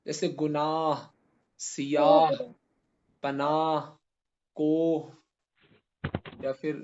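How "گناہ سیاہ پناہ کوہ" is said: slow list, breathing out the h ending گناہ and سیاہ; audible final h. Shown as a demonstration. Teacher lists examples of the sounded h, each final h in گناہ audible. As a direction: sound the final h clearly in پناہ and کوہ. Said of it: "ایک تو وہ جو ظاہر ہو".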